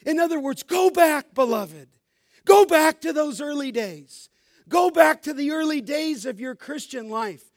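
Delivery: rising to a loud preacher's shout on each "go back"; preaching at full force. Acted. Pastor urging the congregation to go back, full-force preaching. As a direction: pound each "Go back" harder, building.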